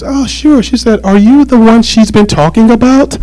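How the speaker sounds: high-pitched imitation of her supervisor; woman supervisor. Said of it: "her supervisor answered the phone".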